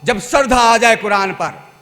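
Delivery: loud, driving sermon voice; fervent preaching. Acted. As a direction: preach it out to the crowd. Preacher exhorts loudly.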